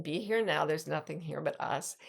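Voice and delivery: normal talking voice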